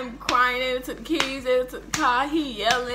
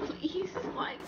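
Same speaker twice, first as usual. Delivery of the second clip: higher, softer, tiny please; soft begging; 8 semitones higher, 3 dB quieter, much slower than usual. Retold or imitated. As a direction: plead small and scared. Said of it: "And I'm just like".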